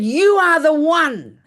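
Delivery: loud, high, drawn-out mock yell; hammed-up screamed accusation; intense accusation. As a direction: imitate the screaming neighbor, slow and loud. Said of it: "It was so intense".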